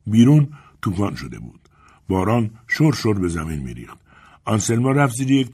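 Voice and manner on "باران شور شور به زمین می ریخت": usual speaking voice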